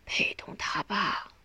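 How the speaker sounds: faint, broken dying whisper; straining to speak. A narrator's reading. Dying mother apologises to her children, straining faintly. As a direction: force out each breathy scrap, with long pauses. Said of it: "用全身的力气说话".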